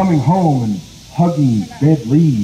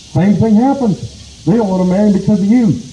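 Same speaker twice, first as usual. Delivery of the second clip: exasperated complaint; 8 semitones higher, 3 dB louder than usual. Acted. Possum complains to Rabbit.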